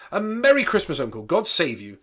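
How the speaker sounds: loud, high festive hail; cheerful cry. Acted. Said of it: "cried a cheerful voice".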